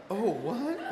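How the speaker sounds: high, rising disbelief; startled reaction. Retold or imitated. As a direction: jump in pitch, taken aback. Startled by the chanting.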